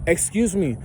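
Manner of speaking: mock lady voice. Lady interrupts asking about kale.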